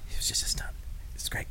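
whispered confession; hushed whisper of a guilty secret. Pretend. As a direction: breathe it out, voiceless and secretive. Tom Cruise confessing quietly.

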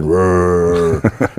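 low rolling growl like a spinning hose; imitated growl. Telling a friend about the uncle, low rolling growl.

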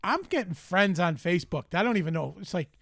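ordinary talk, nothing marked

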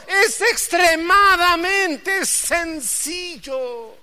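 high, loud and very slow; emphatic slow repeat. Shown as a demonstration. Preacher leading the congregation to repeat it, slow and loud. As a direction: stretch every word for the crowd. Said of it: "A ver, dígale otra vez".

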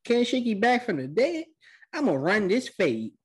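high, hammed-up shock turning to bravado; mock disbelief. Recapper as Sasuke reacting to the symbol, mock shocked bravado. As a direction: pitch it up, cartoonishly incredulous.

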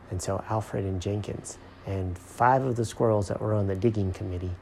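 ordinary delivery

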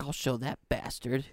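put-on slow, soft, haughty English accent; quiet English accent, drawn out. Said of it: "Nice English accent".

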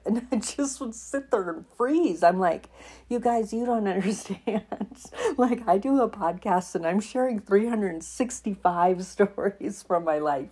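exasperated laughing retelling; laughing through it. Real, not acted. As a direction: laugh in disbelief while telling it. Laughing while recounting frustration.

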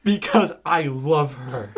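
loud, slow, hammed-up avowal; dramatic declaration. Hagrid answers Snap's question, dramatic loud declaration. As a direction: proclaim it slowly and loudly.

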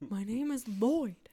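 slow, drawn-out movie hero line; slow character voice. Retold or imitated. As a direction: say it like a cartoon hero introducing himself. Quoting character intro line.

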